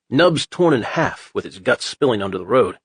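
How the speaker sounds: plain reading voice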